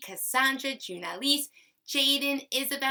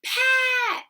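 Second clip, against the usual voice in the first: "Pat" said much higher and louder, blended quickly into one word; excited answer; 10 dB louder than usual. Shown as a demonstration. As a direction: shout out "Pat" like a happy reveal. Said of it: "What's the word?".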